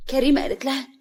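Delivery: ordinary delivery, nothing marked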